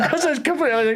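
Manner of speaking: high-pitched, talking through laughter; real laughing disbelief. Real, not acted. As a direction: crack up while confessing your own blunder. Cracking up at his own failure.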